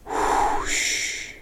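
soft exhaled whoosh, breathed out; quiet whoosh of breath. A narrator's reading. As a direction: let a breath go, barely voiced.

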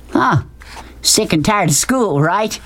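lightly voiced big talker; folksy blustering man. A narrator's reading. Man jokes with sweeping boy, folksy bluster.

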